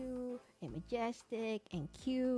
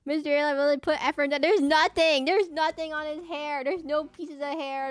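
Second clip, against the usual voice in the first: louder and higher, laughing disbelief; exasperated outburst; 6 semitones higher, 8 dB louder than usual. Real, not acted. Streamer ranting to chat about the bald alien, exasperated. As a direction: complain loudly that he has no hair.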